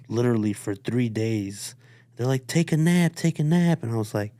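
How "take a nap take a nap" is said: higher, louder mock nurse urging; sing-song push to go rest. Nurses telling the injured fighter to rest, higher and louder.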